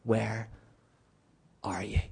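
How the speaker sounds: tender, barely voiced question; gentle whisper. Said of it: "he gently comes down and says".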